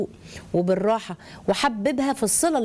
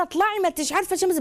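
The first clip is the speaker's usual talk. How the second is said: retold scolding yell; 8 semitones higher than usual. Aunt ordering the little girl out, mock high-pitched yell. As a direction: imitate an aunt yelling, pitched well up. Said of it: "بتزعق".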